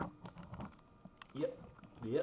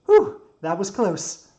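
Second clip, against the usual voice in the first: loud, slow, high-pitched whew; relieved exclamation; 9 semitones higher, 15 dB louder, much slower than usual. Acted. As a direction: shake off a close call out loud. Builder after narrow escape.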